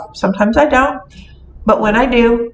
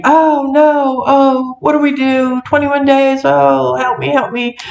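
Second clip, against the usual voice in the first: hammed-up fretting, pitched higher; mock panic; 4 semitones higher than usual. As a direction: pretend alarm, playing it up. Mock panic over Mercury retrograde.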